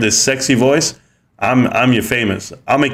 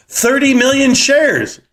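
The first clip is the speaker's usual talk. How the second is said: excited; 13 semitones higher, slower than usual. Real, not acted. Reveal of matching volume number.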